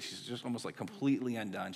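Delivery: usual speaking voice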